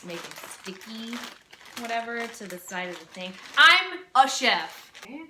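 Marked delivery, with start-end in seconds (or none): 3.6-4.9 slow, loud proud declaration; loud proud boast. Pretend. Cook proclaiming herself a chef to viewers, loud and slow. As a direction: announce it grandly to the kitchen.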